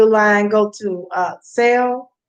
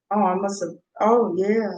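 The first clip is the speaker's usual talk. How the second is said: ordinary delivery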